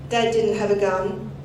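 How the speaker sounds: usual speaking voice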